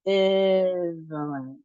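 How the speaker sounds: Ambivalent slowly, syllables drawn out; slow practice model. Shown as a demonstration. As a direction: stretch Ambivalent for learners to follow. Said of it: "So let's try it".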